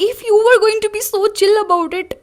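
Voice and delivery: high, loud disbelief at mom; exasperated outburst. Retold or imitated. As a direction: nearly shout it in frustration. (झुंझलाकर ऊँची तेज़ आवाज़)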